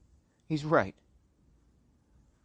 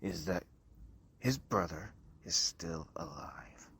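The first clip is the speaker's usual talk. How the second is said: hushed whisper; 5 semitones lower, 6 dB quieter than usual. Acted. Grim truth between the parents.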